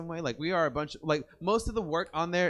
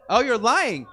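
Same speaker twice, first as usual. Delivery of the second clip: higher, louder mock accusation; playful teasing; 6 semitones higher, 6 dB louder than usual. Real, not acted. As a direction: tease him for lying. Teasing a joker.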